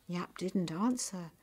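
usual speaking voice

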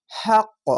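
হাক্ক with the final ক bounced upward; qalqalah bounce. Shown as a demonstration. Teacher demonstrates the qalqalah bounce to pupils. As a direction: knock the last ক of হাক্ক out with a little push. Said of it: "একটু ধাক্কা মেরে".

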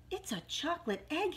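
high, mildly excited discovery; excited cry. A narrator's reading. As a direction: cry out in delight, understated. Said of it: "he cried".

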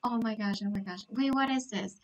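normal talking voice

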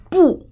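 不 high and falling sharply; short, forceful falling 不; powerful fourth tone. Shown as a demonstration. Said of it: "has powerful tone".

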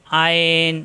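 আইন pressed from the middle of the throat; throaty model আইন; Arabic letter ain. Shown as a demonstration. Teacher models throaty আইন for students. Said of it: "কণ্ঠনালীর মাঝখানে চাপ দিয়ে আইন".

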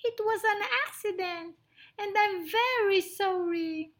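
high, quick apology from a little mouse; frightened mouse. Acted. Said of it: "Midgie was frightened".

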